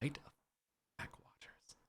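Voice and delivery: breathy whisper of the name. Acted.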